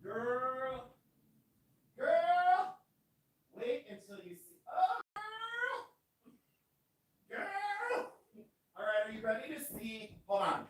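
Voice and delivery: high calls from across the room; yelling from far away; far-off screaming. Real, not acted. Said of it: "Why am I still screaming".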